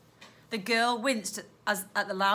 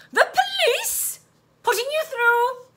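very high, loud switchboard voice; loud piping operator, drawn out; high-pitched operator; 6 dB louder, slower than usual. A narrator's reading. High-pitched operator transfers the call.

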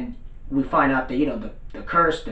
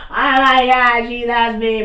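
high, loud mock swoon; lovestruck shock; 8 semitones higher, 5 dB louder, slower than usual. Toda has a girl holding his hand, shocked. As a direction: cry out high as if stunned by love. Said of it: "he's all shocked".

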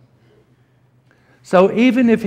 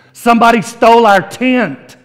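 loud, higher punchline burst; 7 semitones higher, 7 dB louder than usual. Retold or imitated. Preacher to congregation, Sherlock's punchline, loud and high. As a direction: deliver the payoff big and bright.

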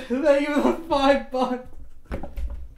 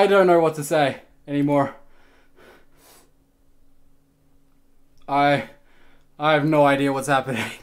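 low, fast, worn-out mumble; drained and exhausted; 8 semitones lower, faster than usual. Real, not acted. Streamer after a donation flood, low and exhausted. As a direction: run out of steam. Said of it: "I'm out of energy".

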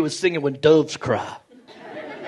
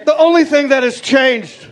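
put-on upset kid voice; 7 semitones higher than usual. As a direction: complain like an aggrieved little boy. Kid voice, aggrieved.

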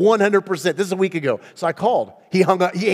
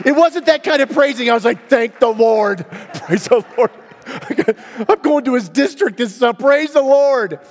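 high, loud joyful preaching; excited praise; 8 semitones higher, 7 dB louder than usual. Real, not acted. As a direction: shout the praise to the room.

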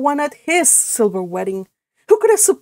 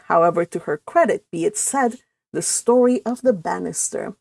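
usual speaking voice

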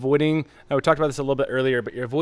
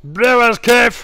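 Brewers Cafe drawn out slowly, high and loud, in a hammed-up French accent; mock French accent; 9 semitones higher, 10 dB louder, much slower than usual. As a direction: stretch Brewers Cafe like a playful fake Frenchman. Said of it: "your French accent".

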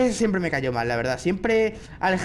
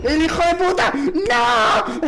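high, loud cry of frustration; outraged yell; 10 semitones higher, 5 dB louder, slower than usual. Real, not acted. Player caught near the goal, outraged yelling. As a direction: explode into a high-pitched curse. Caught just short of escape.

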